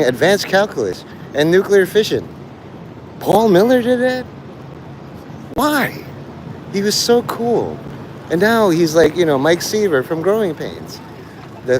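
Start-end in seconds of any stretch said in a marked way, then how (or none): none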